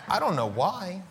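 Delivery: ordinary talk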